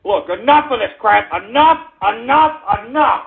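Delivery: usual speaking voice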